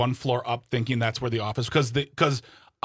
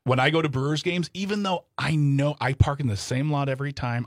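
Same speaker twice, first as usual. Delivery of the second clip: ordinary talk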